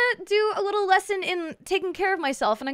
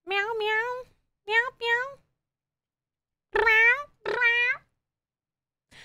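cat meows; 8 semitones higher, much slower than usual. Pretend. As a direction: mew like a little cat. Cat noises at chat.

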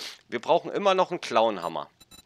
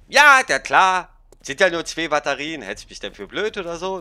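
mimicking girlfriend; 4 semitones higher, 7 dB louder than usual. Freundin nachgeahmt im Streit.

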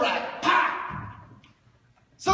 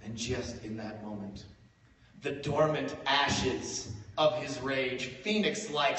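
ordinary talk

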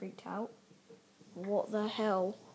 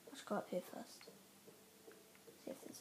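hushed; 15 dB quieter, much faster than usual. Real, not acted. Sneaking, hushed.

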